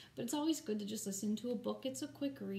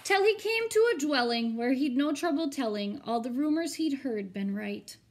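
rhythmic, storybook read-aloud; story narration; 5 dB louder than usual. A narrator's reading. Teacher reading a rhyming picture book to her students, expressive storybook narration. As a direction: read the rhymes to the class with drama.

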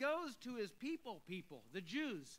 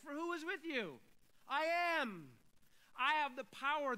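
higher and louder, triumphant; rousing proclamation; 6 semitones higher, 6 dB louder than usual. Real, not acted. Preacher declaring God's power to the congregation, rising and loud. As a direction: lift the room with it. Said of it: "preacher's rousing close".

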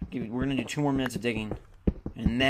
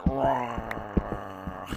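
lion's roar; 2 semitones lower than usual. Pretend. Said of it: "that's my lion's roar".

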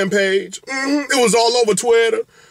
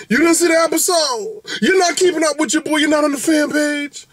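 mock incredulous; 8 semitones higher than usual. Mock shock at viewer.

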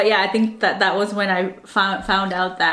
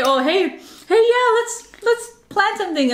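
fake eager; 10 semitones higher than usual. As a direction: gush like someone who won't follow through. Mimicking people who flake.

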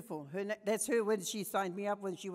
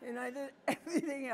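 laughing; 3 semitones higher, faster than usual. Real, not acted. Laughing at her own smoking.

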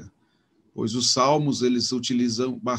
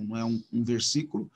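ordinary talk, nothing marked

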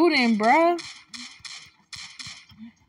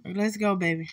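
ordinary talk

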